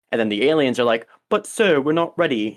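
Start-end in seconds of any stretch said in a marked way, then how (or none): 1.3-2.5 put-on higher Jamaican accent, drifting English; attempted Jamaican accent, sounding English. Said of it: "you know, in, like, a Jamaican accent".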